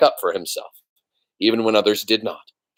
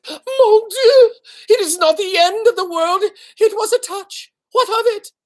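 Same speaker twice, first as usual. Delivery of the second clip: high, loud, frantic French mother; a mother mouse crying out for her son; 5 dB louder than usual. Acted. Mother's shrill French protest.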